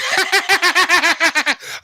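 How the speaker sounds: high giggling fit that won't stop; helpless giggle. Retold or imitated. Patient giggling while the nurse scrapes his burns, helpless giggle. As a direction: giggle helplessly through the pain. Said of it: "and I'd be like".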